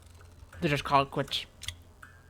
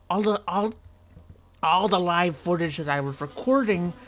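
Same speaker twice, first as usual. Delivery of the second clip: ordinary talk, nothing marked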